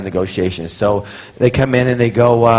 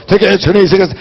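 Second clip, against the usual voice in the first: a light imitation of a scalper calling out, pitched higher; scalper hawking; 8 semitones higher, 3 dB louder than usual. Imitating scalpers at the stadium gate, light hawker call. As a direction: call out tickets like a street hawker. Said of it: "You know how scalpers go".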